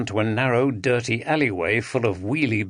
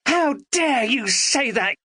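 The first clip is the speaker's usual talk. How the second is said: higher, slow furious outburst; mock scream; 13 semitones higher than usual. A narrator's reading. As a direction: Andrea flares up in outrage. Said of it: "she screamed".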